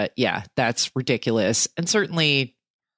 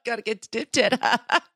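high-pitched joking echo; put-on gag voice; 8 semitones higher than usual. Co-host laughing along with the running joke, silly voice.

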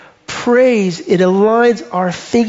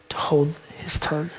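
whisper; 4 semitones lower, 10 dB quieter than usual. Acted. Preacher repeats the poem's last line to the church in a whisper. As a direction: drop to a whisper on the last words.

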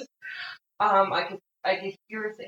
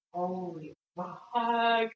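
slow awed swear; much slower than usual. Real, not acted.

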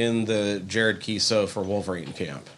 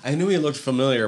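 usual speaking voice

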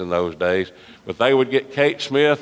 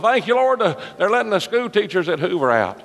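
relieved praise; 10 semitones higher than usual. Retold or imitated.

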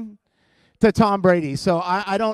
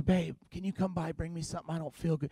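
soft mock sick whimper, much quieter; sick girlfriend whine; 2 semitones lower, 12 dB quieter than usual. Girlfriend calling her boyfriend while sick, softly whimpering. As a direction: play the sick girlfriend, faint and needy. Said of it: "she calls you".

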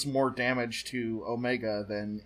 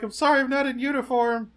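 high-pitched sheepish excuse; mock apology; 11 semitones higher than usual. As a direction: play an apologetic latecomer in a high voice. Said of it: "He's like".

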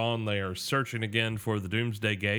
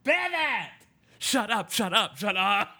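high frantic shout; Ross yelling; 13 semitones higher, 4 dB louder, slower than usual. Retold or imitated. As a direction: yell pivot at the couch. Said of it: "I kept thinking of Ross".

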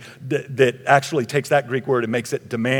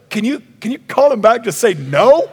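high, loud, protesting question; hammed-up exasperated plea; incredulous pleading; 8 semitones higher, 8 dB louder than usual. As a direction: beg for an easier answer, comically indignant. Imagined pleading with Jesus.

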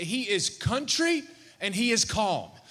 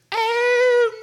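put-on high child voice, small and scared; scared little boy; 14 semitones higher, 8 dB louder than usual. Son on the beach sees his father, high scared child voice. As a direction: voice a frightened kid watching his dad. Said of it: "he says".

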